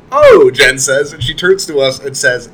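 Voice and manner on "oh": a much higher, louder startled "Oh"; sharp jump of surprise; surprised oh. Retold or imitated. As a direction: caught off guard by the news. Jen reacts to shocking news.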